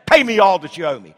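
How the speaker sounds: a touch of an angry creditor; demanding servant. Acted. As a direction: demand the money back. Acting the unforgiving servant.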